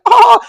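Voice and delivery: high, loud startled "ah!"; mock shocked gasp. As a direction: shriek as if recognized out of nowhere. Retelling startled celebrity's reaction.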